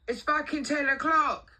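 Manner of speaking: high, loud, slow telling-off; outraged mock yell; indignant boss. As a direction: scold a late worker, imitating the boss. Acting out boss's telling-off.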